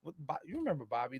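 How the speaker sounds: ordinary talk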